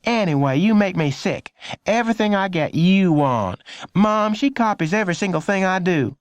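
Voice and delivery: put-on high, sulky child voice; a kid tattling to mom; high whiny little sister; complaining little girl. A narrator's reading.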